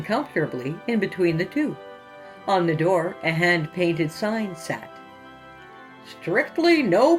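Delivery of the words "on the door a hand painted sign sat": ordinary talk, nothing marked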